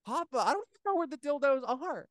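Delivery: high-pitched plea of innocence; squeaky acted-out denial; mock child's protest.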